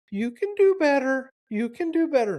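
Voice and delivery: put-on high little-girl voice scolding the puppy; friend's little daughter scolding her puppy in a high child voice. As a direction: play the small daughter wagging her finger at the dog. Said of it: "points it at the dog and says".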